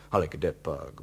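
low grumbled dismissal; grumbling. A narrator's reading. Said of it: "bručel pan Horvát".

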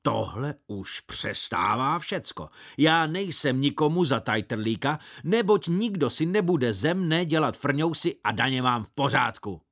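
ordinary delivery, nothing marked

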